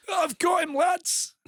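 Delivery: high, loud strained shout to teammates; mock defender's call. Acting out defender calling out.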